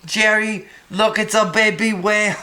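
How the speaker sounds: hammed-up high holler of amazement; excited video guy. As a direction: imitate an over-excited bystander spotting something. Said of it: "Have you seen that video?".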